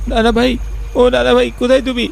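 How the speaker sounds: calling into the night for his grandfather; boy calling out. Acted. (উঁচু গলায় নানা ভাইকে ব্যাকুল ডাক)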